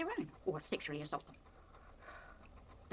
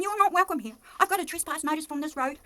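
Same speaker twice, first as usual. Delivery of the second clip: loud and much higher, telling someone off; hostile neighbour; 8 semitones higher, 8 dB louder than usual. Retold or imitated. Neighbours ordering her off the road, loud and high. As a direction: bark it out, shooing her away. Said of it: "They're saying".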